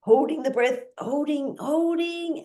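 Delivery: high, strained holding, holding; held breath. Shown as a demonstration. Teacher counts the breath hold, pitch climbing. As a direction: squeeze it out while holding the breath. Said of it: "Do this with me now".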